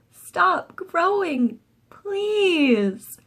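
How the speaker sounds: high, slow pleading; pleading child. Acted. Boy begging his growing fish, high and slow. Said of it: "I said".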